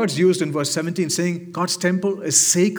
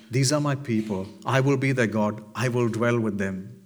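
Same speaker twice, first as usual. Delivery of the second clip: lightly voiced voice from the throne, low; a hint of God speaking, pitched down; 7 semitones lower, 4 dB quieter than usual. God to his people from the throne, lightly voiced. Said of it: "you hear a voice from the throne saying".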